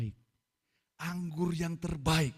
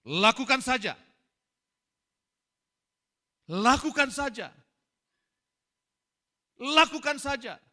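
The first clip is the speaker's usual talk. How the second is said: loud, high, insistent repetition; emphatic urging; 9 semitones higher, 11 dB louder than usual. Real, not acted. Preacher urging the congregation, loud and insistent. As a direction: drive each word home to the church.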